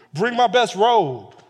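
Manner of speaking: father calling to servants in celebration; joyful father calling. Retold or imitated. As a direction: call it out across the yard. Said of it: "Dad's running out there".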